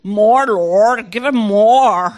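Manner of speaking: low, loud, insistent chant; deep, forceful mock prayer; imitated praying minister. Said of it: "But they keep saying".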